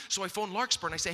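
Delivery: usual speaking voice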